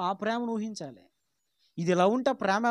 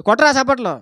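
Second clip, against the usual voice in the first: loud barked order, higher; mother's command; 4 semitones higher, 11 dB louder than usual. Retold or imitated. Mother at family prayer ordering her son to clap, loud command. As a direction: call out sharply for clapping.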